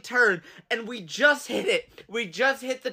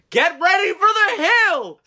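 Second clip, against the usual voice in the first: high yelled warning; shouted warning; 10 semitones higher, 6 dB louder than usual. Retold or imitated. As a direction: yell it to the car from outside. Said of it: "He goes".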